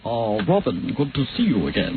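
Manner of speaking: deep Bela Lugosi impression. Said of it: "Bela Lugosi".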